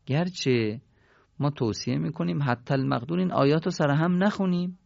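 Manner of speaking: usual speaking voice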